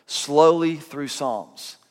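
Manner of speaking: said slowly. Shown as a demonstration. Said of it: "Because I'm reading slowly".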